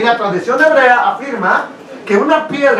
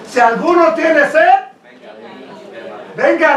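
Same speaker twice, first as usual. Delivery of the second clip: quoted call in a higher voice; high, slightly raised preaching call; 4 semitones higher than usual. Retold or imitated. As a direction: proclaim an invitation to a crowd. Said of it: "alzó la voz diciendo".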